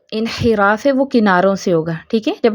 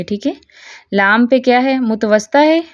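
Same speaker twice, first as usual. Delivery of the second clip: ordinary delivery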